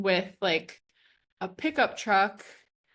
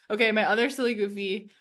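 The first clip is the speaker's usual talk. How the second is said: usual speaking voice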